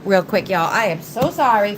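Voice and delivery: ordinary delivery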